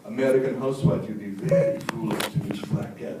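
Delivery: low-pitched Russian oligarch voice; Russian accent. Retold or imitated. Mocking a gas oligarch's warning to housewives, Russian accent. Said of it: "read this in my Russian accent".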